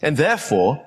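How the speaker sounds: ordinary talk, nothing marked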